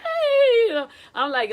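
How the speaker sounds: high, loud cheerleader greeting. Acted. Choir director greeting the choir, cheerleader style. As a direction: call out like a peppy cheerleader. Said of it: "you know, the cheerleader type".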